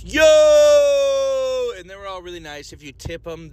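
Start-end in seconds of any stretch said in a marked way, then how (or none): 0.0-1.7 loud high shout of yo; shouted greeting. Retold or imitated. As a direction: call out yo across the shop. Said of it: "everybody in the donut place goes".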